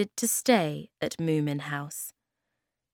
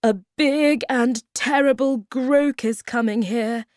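mildly panicked, loud and raised; urgent raised-voice warning; alarmed announcement; 7 semitones higher, 7 dB louder than usual. A narrator's reading. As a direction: break the terrible news in alarm. Hemulin passes on terrible news.

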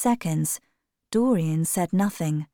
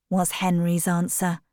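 ordinary delivery, nothing marked

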